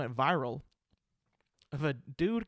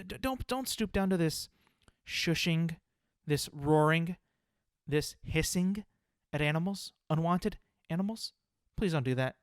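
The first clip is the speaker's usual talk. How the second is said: ordinary talk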